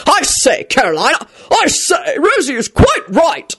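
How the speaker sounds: high-pitched, drawn-out blustering gentleman; booming gentleman. A narrator's reading. Richard telling off Caroline, booming. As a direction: boom it out, stretching the last words. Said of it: "Richard boomed out and glared".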